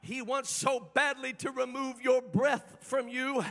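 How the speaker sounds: usual speaking voice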